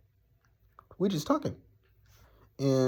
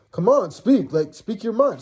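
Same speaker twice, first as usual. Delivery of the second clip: higher-pitched, insistent urging; goading; 6 semitones higher than usual. Retold or imitated. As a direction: retell him egging you on. Retold goading before a confrontation.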